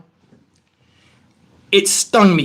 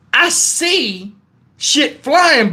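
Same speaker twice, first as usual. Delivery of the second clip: normal talking voice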